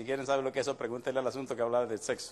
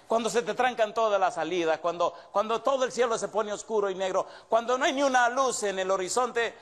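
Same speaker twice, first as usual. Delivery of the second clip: higher and louder, climbing toward the peak; rising preaching fervor; 6 semitones higher, 3 dB louder than usual. Real, not acted. Preacher working up his congregation, rising fervor. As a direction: build the crowd, line by line.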